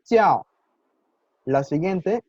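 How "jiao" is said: "jiao" high and loud, falling sharply; fourth-tone demonstration. Shown as a demonstration. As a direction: drop "jiao" hard from a high start. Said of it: "está en cuarto tono". ("jiao" en cuarto tono, fuerte y descendente)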